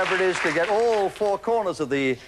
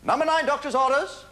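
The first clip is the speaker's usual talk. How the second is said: bingo caller; 10 semitones higher than usual. Acted. Bingo call, high.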